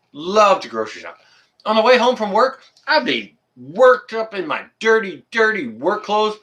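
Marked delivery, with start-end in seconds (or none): 1.6-6.4 excited rant. Real, not acted. Ranting about grocery trips.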